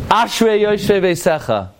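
"Ashrei yoshvei veisecha" recited high with misplaced accents; mispronounced recital. Shown as a demonstration. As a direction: stress the first syllable of "Ashrei" and of "yoshvei". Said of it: "There's no such word as ashrei".

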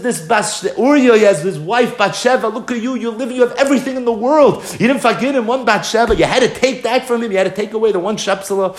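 high, pressing reproach; acted rebuke. Prophet scolding king for taking the one wife, reproachful.

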